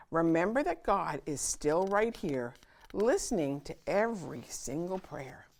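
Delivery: ordinary delivery, nothing marked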